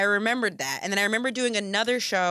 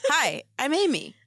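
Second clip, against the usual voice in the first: "Hi, I'm Amy." copied in a higher, lilting introduction; mimicked cadence; 6 semitones higher than usual. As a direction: imitate her exact cadence on "Hi, I'm Amy.", pitched up. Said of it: "in the exact cadence that she had".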